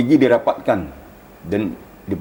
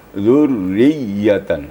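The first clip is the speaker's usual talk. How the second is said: "Zurriyatan" very slow, doubled r and y pressed; slow emphasis demo; pressed tasydid; much slower than usual. Shown as a demonstration. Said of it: "ada penekanan tentang ya".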